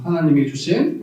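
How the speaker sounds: normal talking voice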